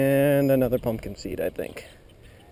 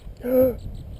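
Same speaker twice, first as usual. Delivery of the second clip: sudden high gasp of shock; sharp gasp; 10 semitones higher, 4 dB quieter than usual. Real, not acted. Angler gasps at a lost fish. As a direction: gasp as the fish gets away.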